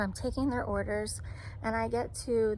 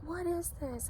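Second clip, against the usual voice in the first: high-pitched, disbelieving question; retold dismay, pitched well up; imitating an upset customer; 8 semitones higher, 4 dB quieter than usual.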